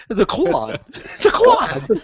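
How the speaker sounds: hammed-up actor impression, pitch jumping up; Christopher Walken. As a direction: playfully mimic a famous actor through laughter. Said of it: "wait, wait, Christopher Walken".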